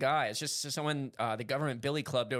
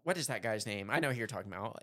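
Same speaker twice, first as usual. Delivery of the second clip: ordinary talk, nothing marked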